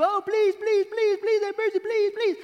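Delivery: a high, whiny, mocking plea; mocking begging. King mimicking the servant's begging to shame him. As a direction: throw his begging back at him in a whine.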